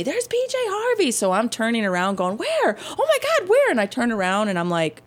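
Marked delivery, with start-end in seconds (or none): 0.0-1.1 squealed star sighting, held back in volume; mock screaming fans. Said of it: "all these people screaming and pointing". 2.4-3.7 very high, frantic fan squeal; hammed-up excited fan; mock starstruck excitement. Acts herself excitedly looking for star.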